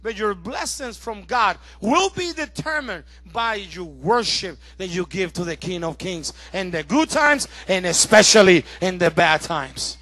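normal talking voice

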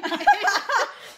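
high burst of laughter; loud high laugh. Real, not acted. Laughing at chili sizes.

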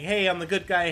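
ordinary talk

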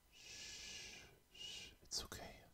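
hushed soothing shh; calm her with a gentle whisper; soft whisper. Acted. Nick soothing crying Judy with a soft whisper. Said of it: "Nick whispered softly".

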